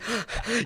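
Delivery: hammed-up sharp intake of breath; imitated gasp. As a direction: gasp like the crying wife. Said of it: "she's breathing".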